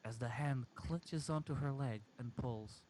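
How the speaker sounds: usual speaking voice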